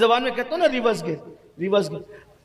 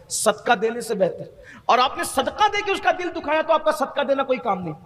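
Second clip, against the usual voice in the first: normal talking voice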